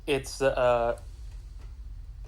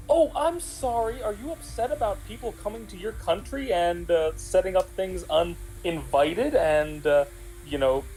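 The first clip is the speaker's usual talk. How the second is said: drip with fake politeness, pitched up; sarcastic; 6 semitones higher than usual. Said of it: "I wanna be like".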